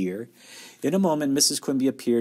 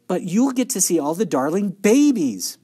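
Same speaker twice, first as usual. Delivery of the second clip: high, loud, eager gush; gushing enthusiasm; 7 semitones higher, 6 dB louder than usual. A narrator's reading. Baby-loving daughter envying mother's new job, gushing enthusiasm. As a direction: gush over the darling babies. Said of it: "Beezus loved babies".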